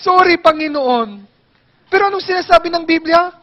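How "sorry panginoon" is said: whiny sorry. Retold or imitated. Continuing the tearful penitent imitation.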